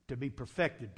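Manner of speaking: ordinary delivery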